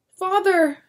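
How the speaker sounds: high, loud shrill cry. Acted. Daughter crying to her father, shrill cry. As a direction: scream for your father. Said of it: "Susanna cried shrilly".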